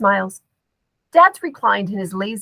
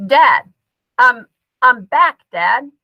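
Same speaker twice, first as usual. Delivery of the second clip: a bit louder, calling him; raised voice; 3 semitones higher, 6 dB louder, slower than usual. A narrator's reading. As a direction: call a little louder to wake him. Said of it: "Scoob raises his voice a bit".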